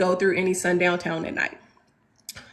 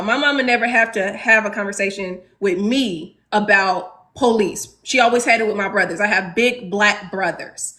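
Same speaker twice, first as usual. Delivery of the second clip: ordinary delivery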